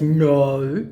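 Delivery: loud put-on "no"; mock scammer denial. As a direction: deny it as the caricature scammer. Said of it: "he goes".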